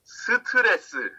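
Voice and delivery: "스트레스" as seu-teu-re-seu, high and quick; Korean pronunciation. Shown as a demonstration. Teacher introducing an English loanword to class, "스트레스" as high seu-teu-re-seu. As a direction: give "스트레스" four Korean syllables, pitched high. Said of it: "how Korean is pronounced".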